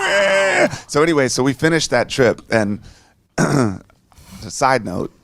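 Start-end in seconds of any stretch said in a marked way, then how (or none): 0.1-0.7 mock gutted groan. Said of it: "I was like".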